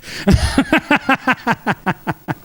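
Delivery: long high-pitched laugh in quick bursts; real rattling belly laugh, pitched high; hearty laugh. Real, not acted. Tickled by his own excommunication threat, hearty laugh.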